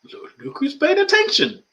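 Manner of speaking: high, loud complaining voice; voice of the discomfort. Acted. Discomfort talking back to the meditator, high and loud. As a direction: speak as the worn-out pain, squeaky and loud. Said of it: "the discomfort itself goes".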